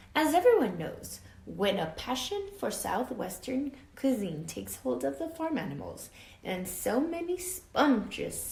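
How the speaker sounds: put-on lively read-aloud for children; story narration. A narrator's reading. Teacher reading a storybook to her class, lively read-aloud. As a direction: tell it like a picture book to kids. Reads picture book to class.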